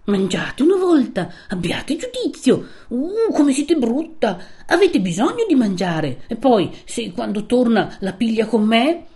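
wheedling with irritation, pitch swooping up; peevish pleading. A narrator's reading. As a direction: beg crossly, voicing an exasperated old woman. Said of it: "di supplicazione stizzosa".

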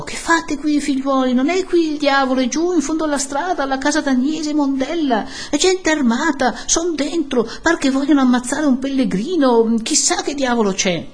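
high, urgent alarm; crying out. A narrator's reading. Breathless newcomer warns villagers of armed men, crying out. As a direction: cry the news to a crowd. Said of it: "gridò".

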